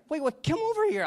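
very high, loud flustered summons; retold shock, voice jumping right up; astonished. Preacher calling the healed woman over, astonished. He reacts stunned to healing.

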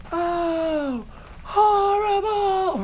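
very high, drawn-out ghostly wail; hammed-up high, loud cry; imitated stage yell. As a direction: yell it like a ghost at full volume. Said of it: "He's yelling at the top of his lungs".

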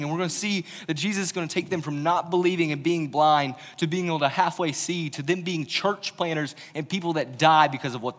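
loud, driving sermon delivery; preacher driving the point home, loud; full-force preaching. Acted. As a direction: preach it to the back row.

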